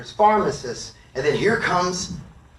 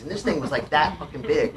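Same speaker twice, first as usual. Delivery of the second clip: normal talking voice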